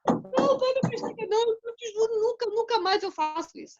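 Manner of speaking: put-on high, wailing child's sobbing plea; crying child. Caught son crying to his father, high and pleading. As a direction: beg through tears like a scared little boy.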